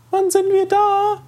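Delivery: put-on very high, drawn-out child voice; little sister's voice. A narrator's reading. Little sister asking from the back seat, high drawn-out voice. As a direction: pipe the question slowly, extremely high. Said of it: "die Stimme seiner Schwester fragen hörte".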